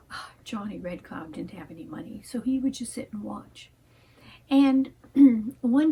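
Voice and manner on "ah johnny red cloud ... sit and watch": soft hushed whisper, telling a tale; bedtime-story whisper; whispered storytelling. A narrator's reading.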